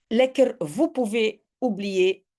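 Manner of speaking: ordinary delivery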